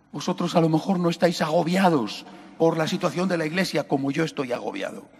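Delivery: ordinary talk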